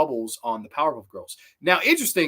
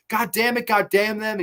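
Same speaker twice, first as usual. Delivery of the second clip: high, raised mock rage as Beast Boy; angry outburst; 8 semitones higher, 4 dB louder than usual. Beast Boy throwing things in his room, furious. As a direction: curse out in frustration.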